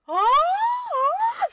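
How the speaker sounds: high, loud excited squealing; overexcited mock squeal; imitated squeal. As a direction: mimic her shrieking at the pens. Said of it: "hat die da drin rumgeschrien".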